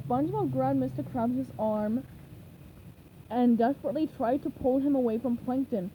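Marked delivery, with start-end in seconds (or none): none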